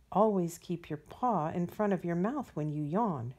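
ordinary delivery, nothing marked

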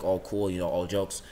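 ordinary talk

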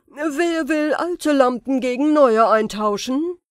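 laut und höher ausgerufen wie ein Straßenhändler; Ausrufer. A narrator's reading. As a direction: wie ein Händler über den Platz rufen. (Zauberer ruft vor dem Palast, laut wie ein Marktschreier)

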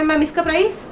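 ordinary talk

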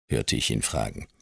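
ordinary delivery

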